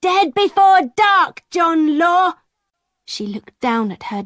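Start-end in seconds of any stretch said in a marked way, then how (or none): 0.0-2.3 shouted curse. A narrator's reading.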